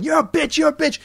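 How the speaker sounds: shrill high repeated mock scream. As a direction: scream it high, over and over, like an enraged wife. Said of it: "he's screaming at her the whole time".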